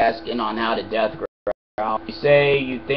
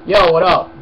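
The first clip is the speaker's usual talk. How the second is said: loud casual phone hello; phone greeting; 5 semitones higher, 10 dB louder than usual. Real, not acted. Roommate calling, loud casual phone greeting. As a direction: answer the call up and loud.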